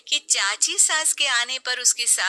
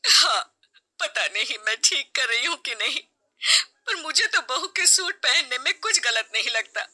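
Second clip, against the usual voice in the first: higher, tearful doubt; upset mother-in-law; 4 semitones higher than usual. Acted. Mother-in-law asking her husband if she was wrong, tearful. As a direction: pour out her worry.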